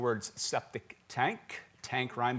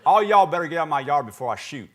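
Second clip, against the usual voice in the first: loud, fast Southern threat; hammed-up Southern warning shout; mock angry Southern homeowner; 2 semitones higher, 10 dB louder, faster than usual. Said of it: "an emphatic form of that".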